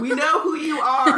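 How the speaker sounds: loud, high-pitched, amused protest; mock exasperation. Reader heckles the Minister's introduction, loud mock exasperation. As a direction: exclaim it at the character, half laughing.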